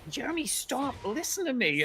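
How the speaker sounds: high, urgent pleading voice; acted desperate appeal; Evan. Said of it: "And Evan's like".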